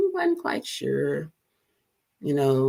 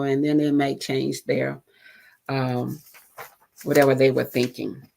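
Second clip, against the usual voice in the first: ordinary delivery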